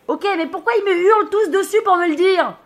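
high-pitched hollered complaint; shouting back up. Acted. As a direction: protest upward to someone on the mast. Shouting back up to friend on mast.

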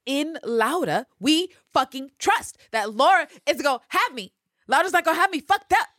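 rally the fandom, voice soaring; hyped shout. Real, not acted. Host rallies fans behind Laura, hyped and shouting.

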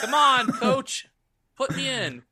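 lightly acted, high drawn-out begging; mock player's plea. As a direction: plead like a benched player, playfully.

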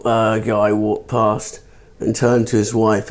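ordinary delivery

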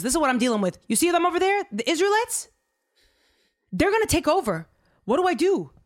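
high, anxious king pointing at the enemy; worried king asking for help; panicked king. Retold or imitated. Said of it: "The king goes".

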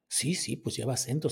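ordinary delivery

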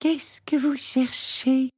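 put-on slow, breathy whisper of a shy girl; whispered question. A narrator's reading. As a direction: whisper it timidly. Girl approaches Santa.

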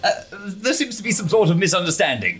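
sheepish placating. Acted. Character placating Bridget amid chaos.

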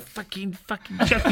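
high-pitched mock-French swearing; Wenger. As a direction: imitate an unlikely swearer in a French accent. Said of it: "in a French accent".

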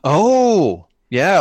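ordinary talk

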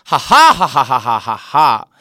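loud mocking cackle; gloating laugh. Real, not acted. Gloating over claiming another role.

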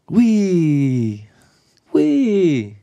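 high, bright squeal of delight; excited exclamation. Shown as a demonstration. Teacher demoing a Māori exclamation, excited. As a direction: let the wee fly up high. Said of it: "these little exclamations in te reo".

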